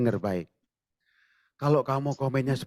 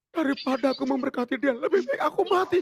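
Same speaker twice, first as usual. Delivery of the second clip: bitter protest; 11 semitones higher than usual. Retold or imitated. Jonah protesting to God.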